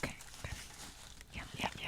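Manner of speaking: barely audible whisper; whisper, keep it hushed. Real, not acted. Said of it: "Everyone shh".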